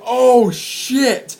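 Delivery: high, loud-edged retold gasp of alarm; shocked exclamation. Crowd seeing the man jump off, shocked. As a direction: react as the crowd watching him leap.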